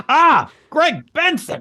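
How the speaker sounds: high, slow, drawn-out cry of the name; mock expletive. Cursing by stubbing a toe, a hammed-up high slow outcry. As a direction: blurt it out like a curse, stretched long and high. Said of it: "sometimes just like".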